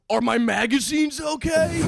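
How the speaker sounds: high, loud, slow mock shout; mock worried friend. Friend shouting about his magazines. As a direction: ham up the friend's panic over his magazines.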